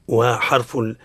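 ordinary talk

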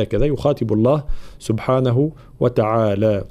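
ordinary delivery

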